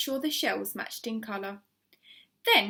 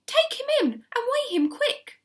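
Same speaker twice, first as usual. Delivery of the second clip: high, louder urgent command; urgent order; 6 dB louder than usual. A narrator's reading. Mister Hoppy urging Missus Silver, urgent order. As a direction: hurry her along. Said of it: "Mister Hoppy ordered".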